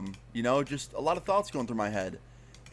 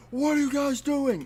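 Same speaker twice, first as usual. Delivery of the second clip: high, loud imitation of a startled attacker; retold angry yell; 13 semitones higher, 6 dB louder than usual. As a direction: yell it like a man confronting intruders. Retold knife-wielding man confronts intruders.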